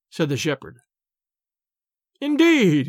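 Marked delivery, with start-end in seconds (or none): 2.2-2.9 mocking indeed. A narrator's reading. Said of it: "and he laughed to himself".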